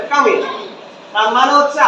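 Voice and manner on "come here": "Come here" as short kamia; native linking. Shown as a demonstration. Teacher answers what natives say, fused "Come here". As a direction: squeeze "Come here" into one word. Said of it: "ওরা কি বলে?".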